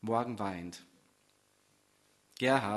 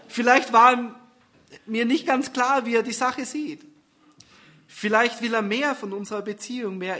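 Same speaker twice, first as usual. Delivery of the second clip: higher, louder uncertain thoughts; second-guess yourself a little higher; anxious girlfriend; 6 semitones higher, 6 dB louder than usual. Acted. Susanne wondering what Gerhard wants, higher and uneasy.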